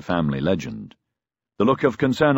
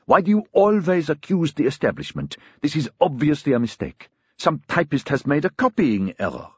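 high-pitched, heated argument; indignant cry; 6 semitones higher than usual. A narrator's reading. Brother defending the establishment to his sister, indignant. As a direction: protest angrily, pitch held high. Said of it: "he cried".

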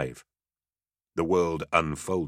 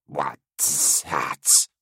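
rasping creature voice mimicking her words; creature echo; 3 semitones lower than usual. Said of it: "echoing her sounds without understanding them".

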